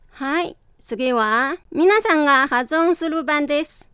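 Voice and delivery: usual speaking voice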